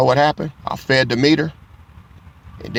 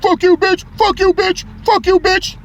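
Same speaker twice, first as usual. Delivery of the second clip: much higher, loud pretend screaming; mock furious yell; 5 dB louder than usual. Younger him would have cursed the officer, pretend furious yell. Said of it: "I could have been like".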